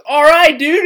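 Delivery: put-on loud, high drunken holler; boozy, over-the-top bellow; drunk friend. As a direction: impersonate a very drunk buddy shouting. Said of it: "he was very drunk and he goes".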